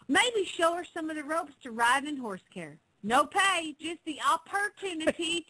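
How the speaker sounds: thick country Southern ad read; Southern accent. Acted. Co-host reads a horse ad, hammed-up Southern accent. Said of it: "you have to do your Southern accent".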